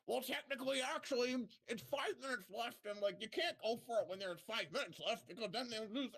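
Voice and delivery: lightly imitating a smug online nitpicker; nitpicking know-it-all. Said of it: "the randoms come out of the goddamn woodwork".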